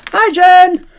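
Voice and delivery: high, loud holler; shouted greeting. Real, not acted. Streamer greeting a chat viewer as if she could hear, shouting. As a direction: shout it out as if to someone far away. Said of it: "Shouted like she could hear".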